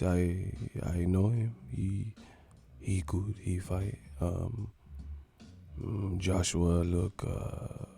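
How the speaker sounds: put-on low, hushed, halting accented voice; imitating a soft-spoken boxer, near whisper; Miguel Cotto whispering. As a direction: grope softly for each word. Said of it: "let Cotto tell it".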